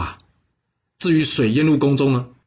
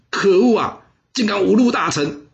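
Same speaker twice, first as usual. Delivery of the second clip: furious outburst; 8 semitones higher than usual. Acted. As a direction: spit out the outrage. Bo Pi insulted, fumes aloud. (愤怒大喊)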